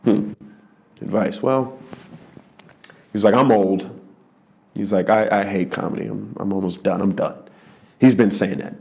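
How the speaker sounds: low, soft, worn-out grumble; tired veteran who is done with it all; weary Patrice. Retold or imitated. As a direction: Patrice advising the young comic, jaded and low. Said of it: "looks me dead in the face and goes".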